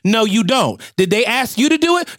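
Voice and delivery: high scolding grandma voice. Pretend. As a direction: pitch way up and scold the boy. Grandma scolding young man.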